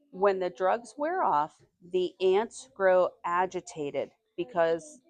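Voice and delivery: slow, deliberate read to the class; slow reading aloud. Acted. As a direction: read each word out slowly for young listeners. Teacher reads passage to class.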